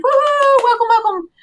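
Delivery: loud, high whooping welcome; excited cheer. Real, not acted. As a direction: whip up the crowd for the guest. Cheering the guest onto the show.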